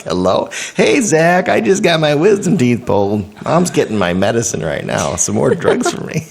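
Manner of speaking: high, loud giddy phone chatter; drugged giddy self. Retold or imitated. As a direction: babble like a high patient. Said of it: "Zach's like".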